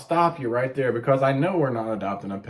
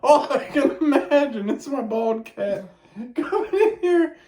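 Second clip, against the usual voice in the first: much higher, talking through real laughter; cracking up while trying to speak; giggling words squeezed out high; laughing; 12 semitones higher, slower than usual. Real, not acted.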